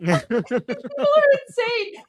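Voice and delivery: high, slow laughing words. Real, not acted. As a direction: laugh through it.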